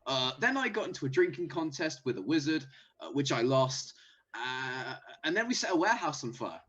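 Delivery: character voice. Acted. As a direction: rattle off the misadventures. Player voices character reporting back.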